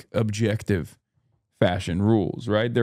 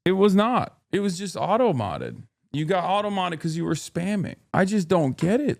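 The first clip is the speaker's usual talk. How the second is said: higher-pitched, exasperated pushback; 6 semitones higher than usual. Real, not acted. Streamer corrects angry chatters about auto-mod, exasperated. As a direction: set them straight, voice pitched up.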